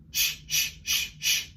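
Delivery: voiceless sh bursts; breath only; whispered sh. Shown as a demonstration. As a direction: push air out in short shushes. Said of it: "diaphragm warm up".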